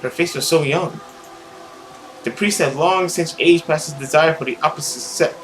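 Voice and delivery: ordinary delivery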